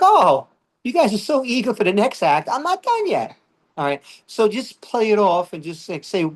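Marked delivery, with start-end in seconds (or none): 0.0-3.4 high-pitched comeback to a heckler; mock protest. Comedian answering the crowd's heckle, high mock protest. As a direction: protest in a climbing mock-indignant voice.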